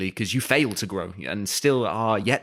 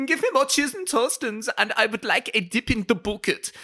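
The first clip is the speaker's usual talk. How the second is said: put-on very high, squeaky kid voice; high childish voice; 12 semitones higher than usual. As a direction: whine like a greedy little boy. Imitating himself as child.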